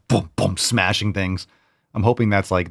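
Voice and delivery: usual speaking voice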